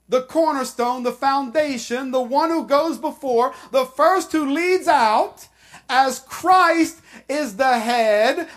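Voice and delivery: full-voiced pulpit preaching; forceful preaching. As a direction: proclaim it with rising force.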